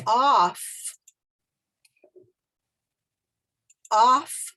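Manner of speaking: off said loud with an open ah; model word. Shown as a demonstration. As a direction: stress the open ah in off. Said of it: "when I say the word".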